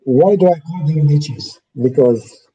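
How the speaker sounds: usual speaking voice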